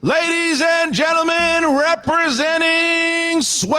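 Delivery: drawn-out ring announcer shout. Real, not acted. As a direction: stretch the name out, boxing style. Announcing the winner.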